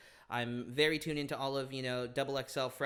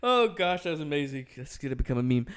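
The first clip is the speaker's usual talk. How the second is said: laughing; 9 dB louder than usual. Real, not acted. Reacting to a bad accent.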